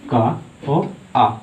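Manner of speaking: का और आ split apart, each sound drawn out slowly; sound breakdown. Shown as a demonstration. Teacher breaks a syllable for students, slow and drawn out. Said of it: "इसे तोड़ेंगे तो क्या निकलेगा".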